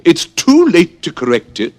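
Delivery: high, loud, stern queen voice; Red Queen. Acted. Red Queen denying Alice's correction, high loud and stern. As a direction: pronounce it like an unbending queen. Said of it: "said the Red Queen".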